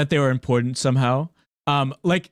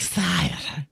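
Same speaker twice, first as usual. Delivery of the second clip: snake voice; 5 semitones higher, 4 dB quieter than usual. Retold or imitated. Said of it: "It has a snake voice".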